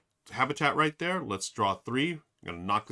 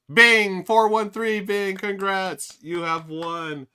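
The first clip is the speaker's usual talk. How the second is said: loud, high, drawn-out game-show reveal; excited winner announcement; 10 semitones higher, 11 dB louder, slower than usual. Real, not acted. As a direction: belt out the winner slowly, pitched up high.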